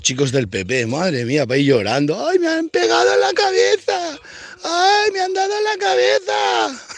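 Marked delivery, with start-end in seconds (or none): 2.3-6.9 high whiny fake wail, hammed-up; mock crying. Mocking protesters who got hit, with a high fake wail. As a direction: wail like a crybaby pretending to be hurt.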